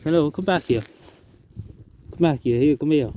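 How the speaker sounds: coaxing, lifted call, short phrases; calling a bird. Real, not acted. Vlogger calls the departing robin back, coaxing. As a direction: call a small creature back to you.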